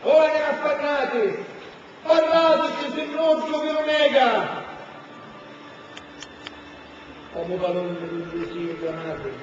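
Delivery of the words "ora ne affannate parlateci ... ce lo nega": high ringing cry, slowing down; dramatic shout. Acted. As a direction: call out to the spirits. Urgent call to the spirits.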